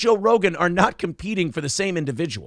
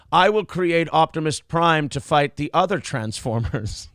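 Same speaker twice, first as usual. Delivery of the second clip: put-on slow, declarative German-accented parody; deliberate German-accented imitation, slowed down. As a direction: announce it in a mock German accent. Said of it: "you give him a German accent".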